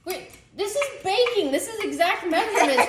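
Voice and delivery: high-pitched, indignant protest; mock outrage. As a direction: protest with comic exasperation. Mock outrage over baking measurements.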